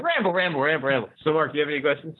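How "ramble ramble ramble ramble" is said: mock rambling.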